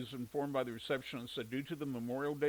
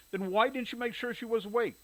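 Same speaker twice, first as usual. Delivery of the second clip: exasperated; 8 semitones higher, 6 dB louder than usual. A narrator's reading. Angry brother confronts sister.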